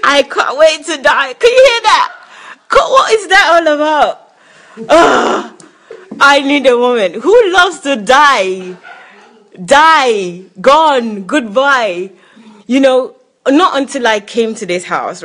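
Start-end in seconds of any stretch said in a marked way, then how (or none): 0.0-1.3 slow, higher, louder mock echo of his claim; mocking his words. Co-host throwing the pastor's words back at him, mocking and exasperated. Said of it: "as he was saying". 1.3-5.6 high, loud, near-shouting protest; upset outcry. Real, not acted. Co-host protesting to the audience, high and upset. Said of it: "I'm upset". 6.2-12.1 upset. Real, not acted. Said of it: "I'm upset".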